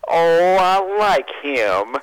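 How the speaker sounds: loud, high, stretched-out punch line; slow, drawn-out relish. Retold or imitated. Storyteller as the pitcher sizing up his next target, drawn out. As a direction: draw it out with mock delight.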